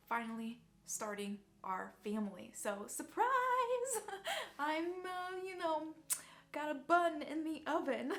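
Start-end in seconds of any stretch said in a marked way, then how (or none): 3.3-4.5 high, loud burst of laughter; happy laugh. Real, not acted. Telling viewers she is pregnant, high delighted laugh. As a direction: laugh out the surprise news.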